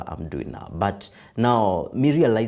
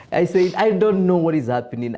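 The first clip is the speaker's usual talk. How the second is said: laughing; 11 semitones higher, 5 dB louder than usual. Real, not acted. Laughing while retelling his reply.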